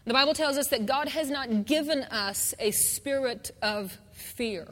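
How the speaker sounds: ordinary talk, nothing marked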